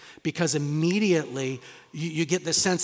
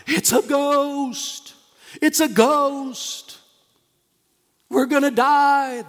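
high, loud terrified cry of frightened fishermen; panicked scream; 10 semitones higher, 8 dB louder, much slower than usual. Retold or imitated. Disciples in the boat screaming in panic at the ghost. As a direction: shriek it like men who think they'll drown.

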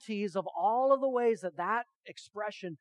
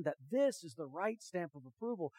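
normal talking voice

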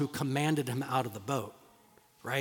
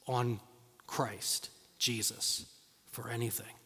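low, much softer closing words; hushed emphasis; 5 semitones lower, 10 dB quieter than usual. Real, not acted. Preacher to congregation, hushed earnest close.

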